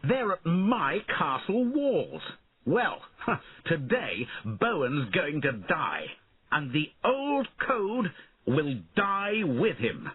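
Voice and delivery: put-on high, slow, gloating boy king voice; young tyrant savouring victory, high and slow. A narrator's reading. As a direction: relish the enemy's doom with a laugh. Angry king at castle walls.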